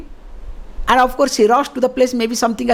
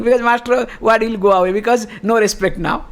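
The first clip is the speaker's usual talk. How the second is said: normal talking voice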